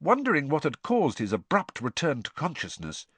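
ordinary delivery, nothing marked

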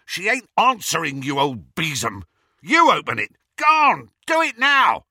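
loud, high, bullying commands; furious, impatient barking; angry shouting. A narrator's reading. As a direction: yell rudely, building to the last order. Amycus yells orders at professor.

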